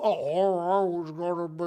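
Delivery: slurred, garbled mumble; glitching old man. Acted. Comic mocking an aged politician's freeze, slurred malfunctioning groan.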